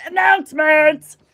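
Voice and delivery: loud, high, showy segment title; hammed-up announcer call. Host launching the announcements segment, hammed-up call. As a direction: belt out the segment title with mock fanfare.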